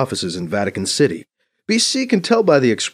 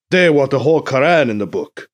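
louder, exasperated complaint; 5 semitones higher, 6 dB louder than usual. Acted. Mbeke objecting to what goes in the book, exasperated. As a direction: push it out with frustrated force. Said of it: "says in exasperation".

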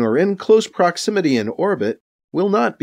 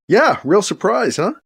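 higher-pitched, half-hearted joking; half-hearted joke; 6 semitones higher than usual. A narrator's reading. B C jokes with Edwards about the invitation, half-heartedly. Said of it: "B C jokes, half-heartedly".

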